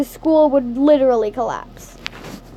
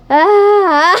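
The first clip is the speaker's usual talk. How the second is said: burst of laughter; 4 semitones higher, 5 dB louder than usual. Real, not acted. Narrator breaks up laughing.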